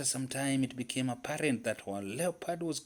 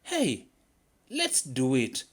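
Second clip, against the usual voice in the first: excited; 3 semitones higher, 5 dB louder, slower than usual. A narrator's reading. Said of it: "Leopard too was beginning to get excited".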